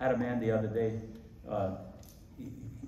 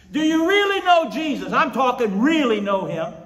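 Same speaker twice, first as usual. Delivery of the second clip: high, shouted challenge to churchgoers; preaching at full force; 13 semitones higher, 13 dB louder than usual. Acted. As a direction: press the question home at a shout. Preaches the cried question to churches.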